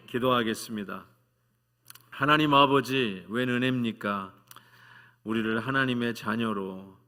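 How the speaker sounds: 열정적인 대표 기도; fervent prayer. Real, not acted. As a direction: pray aloud over the congregation. Leads closing prayer.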